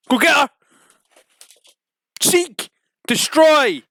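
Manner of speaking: loud high attack commands; commanding. Acted. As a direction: sic the dog on her.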